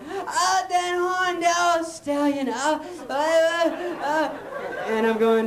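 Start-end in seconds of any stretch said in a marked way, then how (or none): 0.1-4.3 high, slow mock swooning; swooning girls. Girls fawning over Dan Horn, high swooning voice. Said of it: "they're all going".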